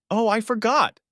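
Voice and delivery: put-on high, sudden little-girl excitement; girl suddenly remembers her wish, excited; excited child. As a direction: remember with a squeal of delight.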